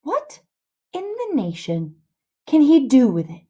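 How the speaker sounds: high "what", then spaced-out words; baffled exclamation. Acted. Huck asks Tom what use a ladder is, baffled. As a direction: break it up in sheer bafflement.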